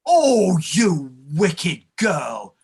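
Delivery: a cry of horror, then a slow low shout; accused clerk bursting out in horror; horrified outburst. Acted. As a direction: recoil and bellow the accusation back. Accused of fathering her.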